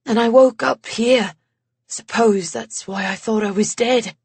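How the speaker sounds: lightly voiced English man, apologetic; a hint of an English accent, recalling; light English accent, rueful memory. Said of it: "that English accent of his".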